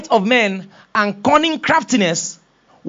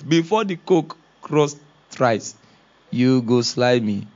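lower, slow and steady prophecy; calm Jesus; 9 semitones lower, 3 dB quieter, slower than usual. Acted. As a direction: gently tell him the hard truth. Jesus calmly foretells denial.

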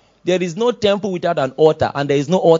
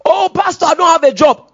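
high-pitched mock lament; acted wail of complaint; complaining church member; 10 semitones higher, 5 dB louder than usual. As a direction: plead with the pastor in a raised, high voice.

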